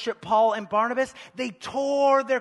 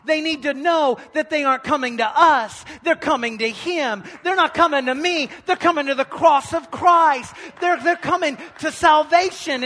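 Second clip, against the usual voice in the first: loud, higher preacher's cry; fiery preaching; 5 semitones higher, 7 dB louder than usual. Acted. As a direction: drive it home to the congregation. Preaching at full force.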